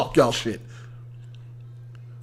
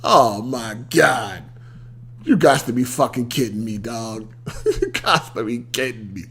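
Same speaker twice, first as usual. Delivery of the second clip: exasperated disbelief; 10 semitones lower, 8 dB quieter than usual. Real, not acted. Host hearing she felt bullied, low weary disbelief. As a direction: sink into weary exasperation, softer and lower. Disbelief at being called bully.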